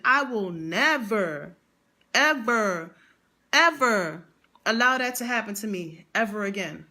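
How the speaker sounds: slow emphatic never, ever, ever; a slow, deliberate vow; drawn-out vow. Retold or imitated. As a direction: stretch each ever out with pauses. Vowing never again, slowed.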